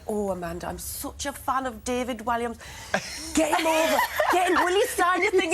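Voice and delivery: a loose, laughing attempt at a Sunderland accent. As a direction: imitate the Sunderland lady, excited and eager, in a rough Sunderland accent. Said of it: "I can't do the accent, but she's from Sunderland".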